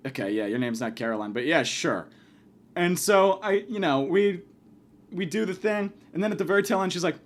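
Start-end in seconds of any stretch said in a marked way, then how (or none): 0.1-2.1 much lower, softer deadpan; dry, resigned acceptance; skeptical undertone. Retold or imitated. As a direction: humour her in a low, doubtful voice. Retold skeptical aside to operator.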